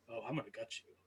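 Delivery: a low, hushed goblin threat; sudden quiet menace. Acted. Goblin Frogward threatening the mercenary after a head pat, hushed menace. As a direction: drop from cheerful to a soft menacing promise. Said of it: "goes from happy to be like".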